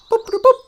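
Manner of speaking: high staccato mouth-made boops; computer beeps. Pretend. Holodeck computer answering a command, imitated beeps. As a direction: imitate a machine responding.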